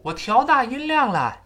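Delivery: high, loud stress across "调大音量"; emphatic, high-pitched "音量" to mirror the English stress; Chinese emphatic stress. Shown as a demonstration. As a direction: punch "音量" as the key word. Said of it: "中国人重读的非常类似".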